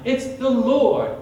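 slow marvelling praise; awed recognition. Acted. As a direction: savour each name in awe. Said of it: "the people in the boat say".

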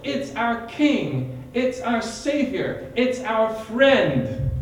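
slow marvelling praise; awed recognition. Acted. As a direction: savour each name in awe. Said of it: "the people in the boat say".

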